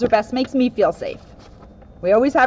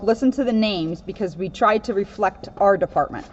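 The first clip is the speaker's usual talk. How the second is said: ordinary delivery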